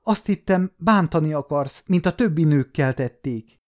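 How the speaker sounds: high, tearful begging; sobbing plea. Acted. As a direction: plead through tears, frightened. (zokogva, magas hangon)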